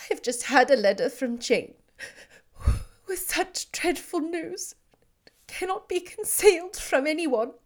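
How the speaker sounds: distraught. Acted. Elizabeth telling Darcy of the elopement, distraught and breaking. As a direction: confess the disaster, voice breaking.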